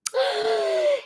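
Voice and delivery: sharp delighted gasp, high in pitch; a sudden delighted gasp on seeing her little dog. Real, not acted. Delighted gasp at the dog.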